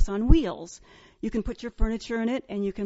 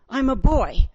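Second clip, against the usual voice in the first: mildly indignant, louder retort; 6 dB louder than usual. Retold or imitated. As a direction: act a slightly offended creature. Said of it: "said rather indignantly".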